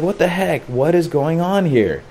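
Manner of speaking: high-pitched retold bewilderment; baffled alarm. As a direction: lift the pitch, mildly panicked. Baffled panic while drifting.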